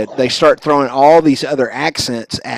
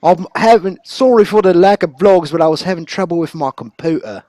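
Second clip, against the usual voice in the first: higher-pitched Suffolk accent impression; Suffolk Andy; 6 semitones higher, 3 dB louder than usual. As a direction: imitate a Suffolk vlogger's accent. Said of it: "I love his accent".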